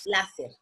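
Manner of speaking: "láser" said as one whole word, low and falling; "láser" spoken smoothly as one word with a falling close. Shown as a demonstration. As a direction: say "láser" as a single word, not letters. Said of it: "las pronunciamos como palabras completas y decimos".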